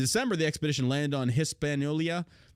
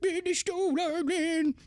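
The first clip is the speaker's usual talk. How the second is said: much higher, slow whiny mockery; mocking whiner voice; slower than usual. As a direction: whine it like a crybaby.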